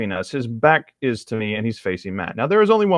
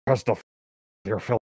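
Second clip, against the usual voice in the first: lightly voiced smug neckbeard. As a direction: play a whiny creep, lightly. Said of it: "okay, neckbeard voice".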